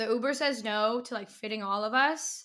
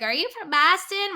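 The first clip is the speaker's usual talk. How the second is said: somewhat higher quick recall of the yell; lighter retold call; 8 semitones higher, 6 dB louder than usual. Retelling the Boston call, lighter and higher. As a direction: lightly echo the earlier call.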